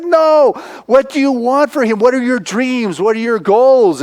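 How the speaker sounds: high, urgent pressing questions; impassioned plea. Retold or imitated. Retelling Tyler pressing the social worker, urgent and high. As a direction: press her hard on what he wants. Pressing her on his dreams.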